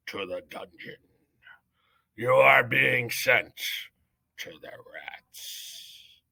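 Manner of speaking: head mouse sentencing Despero to the dungeon; stern judge's sentence. Acted. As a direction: pass sentence with grave menace.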